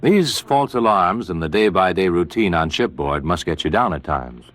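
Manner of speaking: ordinary talk, nothing marked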